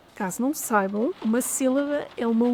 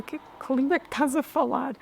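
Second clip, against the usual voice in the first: imitating her puzzled daughter; faster than usual. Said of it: "ficou a olhar para mim com cara de".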